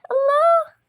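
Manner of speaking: very high, loud burst of joy; thrilled squeal. Acted. Girl handed the ice cream by uncle Samir, high thrilled squeal.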